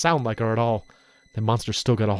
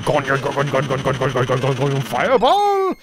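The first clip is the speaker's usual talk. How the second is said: spell incantation. Acted. Pompous villain firing his cannon spell. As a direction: wind up the spell, then hurl fireball.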